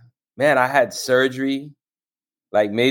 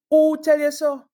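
mock retort. Mock reply to the complaint.

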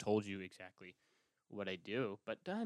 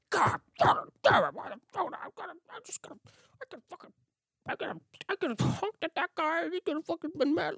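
high-pitched, strained mock tantrum; furious at himself; 5 dB louder than usual. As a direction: throw a hammed-up fit of rage over a missed chance. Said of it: "I will be so mad".

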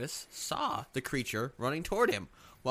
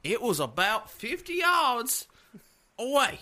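higher Southern preacher voice; 3 semitones higher than usual. Pretend. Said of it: "sound like a Southern preacher".

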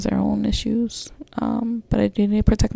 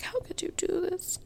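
high, soft mock-crying voice; retold tearful plea; 12 semitones higher, 10 dB quieter than usual. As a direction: imitate a tearful, hushed, high-pitched plea. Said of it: "Uh, crying".

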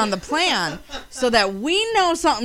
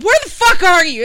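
loud, higher-pitched demand; retold angry demand; 4 semitones higher, 8 dB louder than usual. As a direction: demand answers as in a panicked phone call. Retold panicked call to him.

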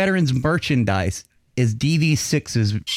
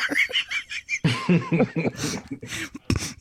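a real, giggly squeal; high squealing laugh; 6 dB quieter than usual. Real, not acted. Laughs at own insult.

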